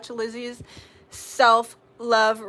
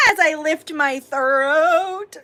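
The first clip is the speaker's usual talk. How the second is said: slow, higher and louder, stretching the words; louder drawn-out repeat; 5 semitones higher, 5 dB louder, much slower than usual. Pretend. Streamer repeating herself to viewers, louder and drawn out. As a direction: belt the line out slowly.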